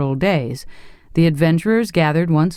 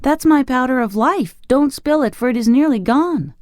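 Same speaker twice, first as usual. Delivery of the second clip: much higher, urgent and anxious; anxious plea; 11 semitones higher than usual. A narrator's reading. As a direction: beg him to be careful. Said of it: "cried the boy".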